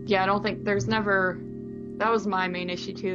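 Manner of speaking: ordinary talk, nothing marked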